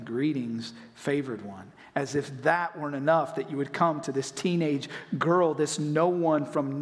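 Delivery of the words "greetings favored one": lower, softer solemn greeting; quiet reverent angel voice; hushed angel greeting. Retold or imitated. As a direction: announce it gently like an angel. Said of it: "showing up and saying".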